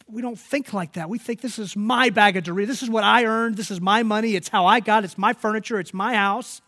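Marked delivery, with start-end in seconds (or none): 1.4-6.6 loud, high, punching each "my"; possessive insistence. Acted. Voicing how we think about possessions, loud possessive insistence. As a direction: stake your claim louder with every item.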